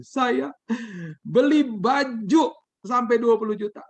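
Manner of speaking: imitating a high, slow wail; tearful confession. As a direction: draw it out high and loud, as if crying. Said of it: "dengan tangisan dia bilang gini".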